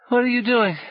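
high, loud, sleepy but strong; just woken and startled; sluggish with sleep. A narrator's reading. Boy woken roughly by Paul, sleepy but strong. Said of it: "Gally's voice was sluggish with sleep, but strong".

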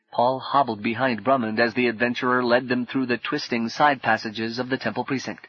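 ordinary delivery

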